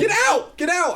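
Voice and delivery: very high, urgent shout; retold yelling. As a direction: yell at the TV to warn them. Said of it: "I was literally yelling at my T V".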